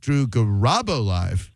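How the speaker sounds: slow Drew Garabo Live, RAB swooping up and down; slow ga-RAAB-o, then a falling Live; exaggerated stress. Shown as a demonstration. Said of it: "I'm gonna say it another".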